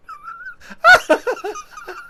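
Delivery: shrieking high laugh; cackling loud and high; burst of high laughter. Real, not acted. Laughing hard at a viewer's comment about throatiness, loud shrieking laugh.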